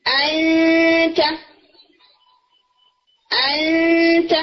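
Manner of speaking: انت drawn out, the n a long nasal hum before t; ikhfa demo. Shown as a demonstration. Teacher demonstrating ikhfa in انت, slow nasal hum. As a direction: hold the nasal of انت long, tongue near the gums. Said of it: "جیسے word ہے".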